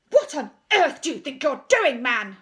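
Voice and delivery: loud, angry demand; indignant loud challenge. A narrator's reading. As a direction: bark it at him. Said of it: "he demanded".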